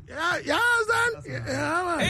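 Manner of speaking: high, slow, shouted through laughter; laughing shout. Real, not acted. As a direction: cry it out mid-laugh. Host overcome, laughing hard.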